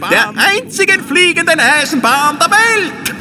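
high, hammed-up fairground barker; market crier. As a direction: call it out to the whole fair. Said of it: "schmetterte der Wurstel lauthals wie ein Marktschreier heraus".

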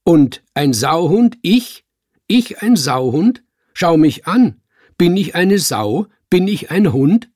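loud, high indignant questions; sharp. A narrator's reading. Cat called a swine-dog, sharply indignant. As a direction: fling the insult back, outraged. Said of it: "genau seiner Schärfe".

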